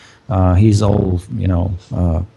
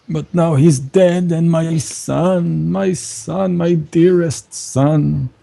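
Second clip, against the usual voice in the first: high, slow lament for a lost son; grieving priest; 5 semitones higher than usual. Acted. Priest telling the strangers about his undead son. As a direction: mourn the son you locked away.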